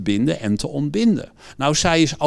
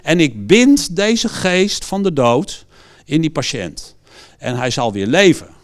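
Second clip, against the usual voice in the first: forceful prayer, raised voice; bold declaration; 2 semitones higher, 4 dB louder than usual. Retold or imitated. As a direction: command it with full authority. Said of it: "Nee, maar".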